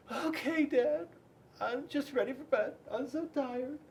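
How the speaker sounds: put-on high, sleepy child's voice; tired little girl. A narrator's reading. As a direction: sigh it out like a worn-out kid. Penelope answers tiredly.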